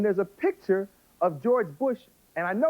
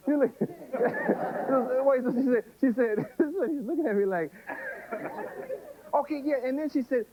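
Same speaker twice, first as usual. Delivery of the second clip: higher voice, broken by real laughter; cracking up; 7 semitones higher than usual. Real, not acted. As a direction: try to carry on while laughing. Cracks up while telling the story.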